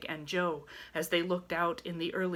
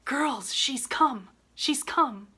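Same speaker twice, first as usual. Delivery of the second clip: higher, louder elated cry; joyful announcement; 8 semitones higher, 5 dB louder, slower than usual. A narrator's reading. Laurie telling the girls their mother has arrived, joyful cry. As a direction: burst out with the happy news. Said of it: "saying in a joyful whisper".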